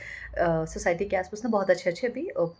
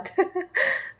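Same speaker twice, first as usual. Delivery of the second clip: high burst of happy laughter; a sudden high giggle of joy; delighted high laugh; 12 semitones higher than usual. Real, not acted.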